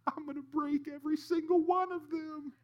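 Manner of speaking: put-on high, laughing child's voice; gleeful kid. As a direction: cackle it out like a delighted little boy. Boy's gleeful reply in the joke.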